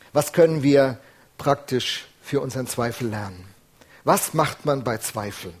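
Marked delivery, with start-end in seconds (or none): none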